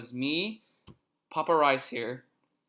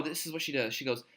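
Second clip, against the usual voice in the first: ordinary talk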